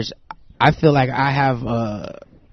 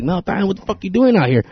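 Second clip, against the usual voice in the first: higher-pitched mock disbelief; astonished fan, imitated; 7 semitones higher than usual. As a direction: imitate a fan's surprised call-out. Surprised fan, retold.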